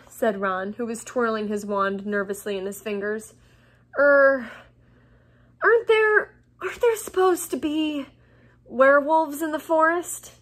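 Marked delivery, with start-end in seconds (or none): 4.0-10.3 higher, louder, hesitant asking; uneasy, halting question; nervous question. A narrator's reading. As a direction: ask with a touch of worry, stumbling on the start. Nervous Ron asks about werewolves.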